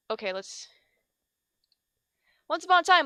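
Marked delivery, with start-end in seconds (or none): 2.5-3.1 bright, high fairy-tale opener; storybook opening. Acted. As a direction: start over like a bedtime story.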